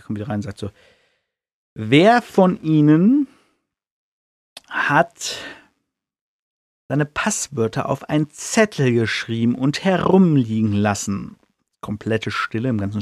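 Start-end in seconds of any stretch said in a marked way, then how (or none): 1.7-11.4 stern McGonagall. Retold or imitated. McGonagall confronts the dormitory.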